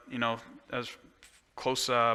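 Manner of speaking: ordinary talk, nothing marked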